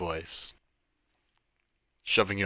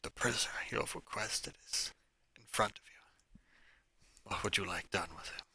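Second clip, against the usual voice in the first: hushed whisper under the breath; whispering very quietly; 8 dB quieter than usual. Acted. Underling quietly reports to his master, in a hushed whisper. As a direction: breathe it out so no one else hears. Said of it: "voice whispering very, very quietly".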